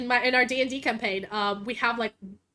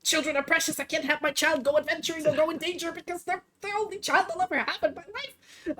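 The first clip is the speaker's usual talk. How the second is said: fretful elf parent; 5 semitones higher than usual. Retold or imitated. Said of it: "that inherent elf".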